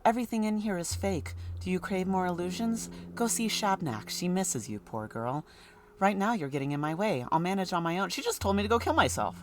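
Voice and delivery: put-on mysterious whisper-like girl voice; eerie girl Clara. Clara warns the doctor.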